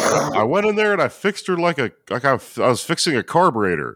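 high-pitched cartoon-dad explaining; excited comic impression climbing high; mock proud excitement. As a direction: imitate a dad gleefully reporting. Acting Hank's proud explanation to Peggy.

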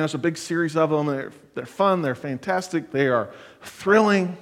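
ordinary delivery

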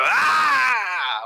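sudden high shout to startle; jump-scare shout. Acted. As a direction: leap out and yell at them. Pumpkin man jumps out.

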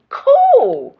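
squealed "Cool." way up high and loud. Retold or imitated. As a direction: chirp "Cool." high and bright with delight. Agreeing to Gail's offer.

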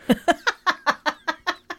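bright high burst of laughter. Real, not acted. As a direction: laugh out at yourself. Laughs at own rambling.

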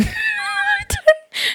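high-pitched squealing laugh. Real, not acted. Host laughing at forgetting the sign-off. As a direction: burst into a very high giggle.

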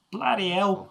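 high, loud British bloody hell; British accent. Shown as a demonstration. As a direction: say it like a Londoner. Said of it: "they pronounce it just".

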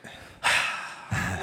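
long, heavy exasperated sigh; withering sigh. Real, not acted. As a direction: let out a long weary sigh. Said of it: "Don't sigh at me".

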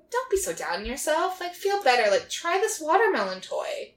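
high-pitched coaxing; mock consoling. Lucifer cheering up the downcast journalist, mock consoling. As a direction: lift the voice to cheer someone up, lightly acted.

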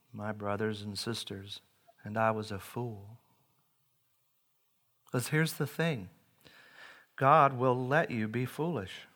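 ordinary delivery

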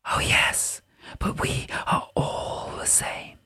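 slow, soft fairy whisper; a sprite whispering wistfully; tinkly bell voice. A narrator's reading. Pyrope answers.

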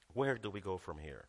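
"Where do we go from here?" dropped low and soft; quiet low repeat. Shown as a demonstration. Preacher musing to congregation, low and softened. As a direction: let it hang, hushed and thoughtful.

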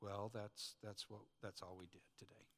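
very low, quiet, faltering admission; sheepish husband. Acted. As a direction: mumble a lame excuse to the wife. Husband's lame answer to wife.

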